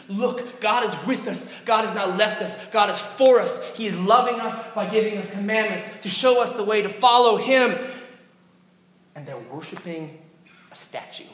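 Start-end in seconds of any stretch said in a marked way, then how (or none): 0.1-7.8 higher, much louder joyful proclamation; thrilled Moses. Acted. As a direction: announce it like overjoyed good news. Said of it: "you can imagine how thrilled he would be".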